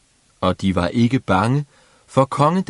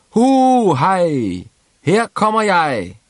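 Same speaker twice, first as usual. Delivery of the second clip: whooping sing-song; 10 semitones higher, 5 dB louder, slower than usual. A narrator's reading. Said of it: "og så sang han i vildens sky".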